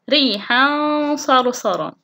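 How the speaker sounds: slow ريحا صرصرا with held nasal hum; tanween ikhfa. Shown as a demonstration. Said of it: "الحالة الثالثة مع التنوين". (إخفاء التنوين بغنّة في ريحا صرصرا)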